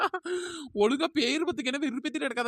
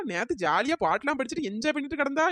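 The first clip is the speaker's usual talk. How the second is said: usual speaking voice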